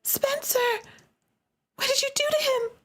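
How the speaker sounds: high, alarmed cry; panicked cry. Acted. Sister seeing her brother collapse, high panicked cry. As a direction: rush it out in panic.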